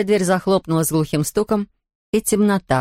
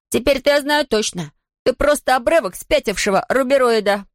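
taunting shout; 7 semitones higher than usual. Acted. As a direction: yell the insult upward. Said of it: "крикнул Том".

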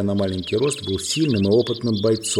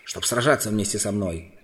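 usual speaking voice

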